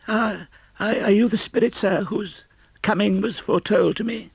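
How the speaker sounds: put-on old miser trembling before the spirit; frightened Scrooge. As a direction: stammer it out, awed and afraid. Scrooge faces the ghost.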